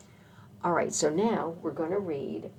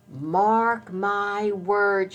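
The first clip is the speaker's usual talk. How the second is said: slow, raised, drawn-out warning; emphatic squirrel warning; 5 semitones higher, 4 dB louder, much slower than usual. A narrator's reading. Mama squirrel warning about hungry creatures, slow emphatic voice. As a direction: lay down the law, very slowly. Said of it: "she say".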